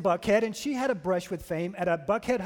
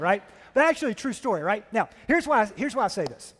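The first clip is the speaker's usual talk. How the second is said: usual speaking voice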